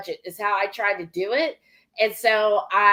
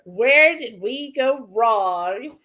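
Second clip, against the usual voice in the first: slow, drawn-out plea; mock lament; slower than usual. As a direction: stretch every word in mock despair. Echoing the dared mock lament.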